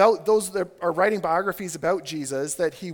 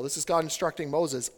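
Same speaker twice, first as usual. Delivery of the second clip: ordinary talk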